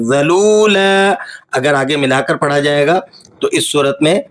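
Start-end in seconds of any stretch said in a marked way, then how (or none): 0.1-1.1 ذَلُولًا with the end drawn out as a long a; stopping with long a. Shown as a demonstration. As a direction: stretch the final of ذَلُولًا at the pause. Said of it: "کھینچ کر پڑھیں گے". (وقف پر ذَلُولًا کو کھینچیں)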